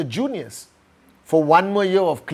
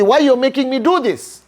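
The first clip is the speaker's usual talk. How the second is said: higher, exasperated demand; a student's baffled cry at his teacher; frustrated outburst; 7 semitones higher than usual. Retold or imitated. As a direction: blurt it out, feeling foolish. Boy confronts master, frustrated.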